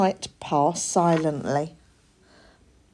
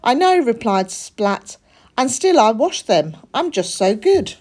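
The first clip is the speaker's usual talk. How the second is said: high, proud little cat voice; a little cat bragging about being good; young cat boasting to mum; smug kid cat; 5 semitones higher, 4 dB louder than usual. A narrator's reading. Splat boasting.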